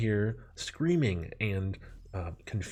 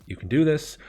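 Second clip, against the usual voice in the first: self pep talk; 6 semitones higher, 6 dB louder than usual. Retold or imitated.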